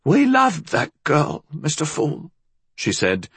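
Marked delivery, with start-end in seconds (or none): 0.0-2.3 put-on high, emphatic old-woman voice; high-pitched fierce protest; fervent old woman. A narrator's reading. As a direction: insist on your love, glowering. Glowering old woman protests love.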